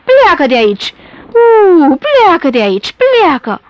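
loud, swooping howl; threatening wind. A narrator's reading. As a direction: howl the orders like a gale. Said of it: "vântul sufla amenințător".

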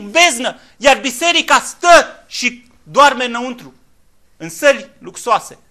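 loud, high preacher's call; fiery sermon at full volume; full-force preaching. Acted. Preaching Joel and rebuke.

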